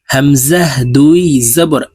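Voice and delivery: slow sing-song letter drill, each line rising; tajweed recitation drill. Shown as a demonstration. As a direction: chant each letter for students to repeat. (ধীর সুরেলা তাজবিদ উচ্চারণ অনুশীলন)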